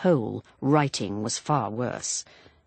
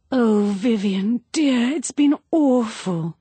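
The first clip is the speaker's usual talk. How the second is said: slow, higher, louder lover's lament; gushing; 8 semitones higher, 5 dB louder than usual. Acted. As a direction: pour it out to him, overwrought.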